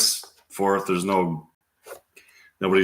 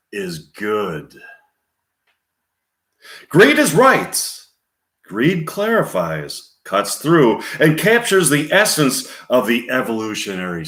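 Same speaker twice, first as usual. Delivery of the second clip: high, loud, deliberate movie speech; Michael Douglas; 8 semitones higher, 4 dB louder than usual. Acted. Addressing gentlemen on greed, emphatic and slow. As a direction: drive each point home, lingering on key words. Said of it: "Scene two, Michael Douglas continuing".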